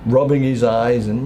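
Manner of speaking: ordinary talk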